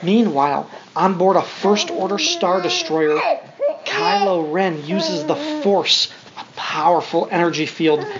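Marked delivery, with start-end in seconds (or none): none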